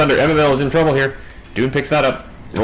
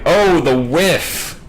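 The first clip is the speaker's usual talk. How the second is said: drawn-out excited oh; excited exclamation; 6 semitones higher, much slower than usual. Real, not acted. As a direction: stretch it out in surprise. Caster reacts to missed skillshot.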